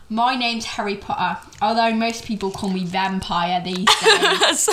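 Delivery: put-on English accent; hammed-up English accent, drawn-out vowels; Harry Potter. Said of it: "I gotta redo this".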